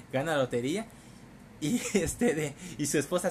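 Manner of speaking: ordinary talk, nothing marked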